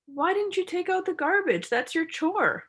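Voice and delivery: complaining. Shown as a demonstration. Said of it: "the person goes".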